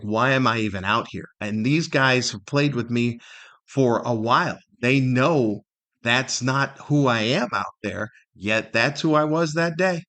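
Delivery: ordinary delivery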